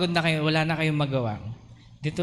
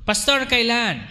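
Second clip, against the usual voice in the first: higher, louder pleading question; impatient congregant; 7 semitones higher, 4 dB louder than usual. Pretend. Congregant pressing the pastor, higher and louder. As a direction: beg for an answer.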